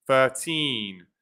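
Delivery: put-on "thirteen" with the weight on "teen"; "thirteen", second syllable stressed. Shown as a demonstration. Teacher modelling the stress in "thirteen". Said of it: "you put the stress in the second syllable".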